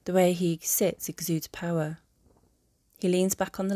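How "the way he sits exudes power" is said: ordinary delivery, nothing marked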